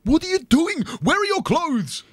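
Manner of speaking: near tears at the sight; shocked. Acted. Guide shocked by naked tourists.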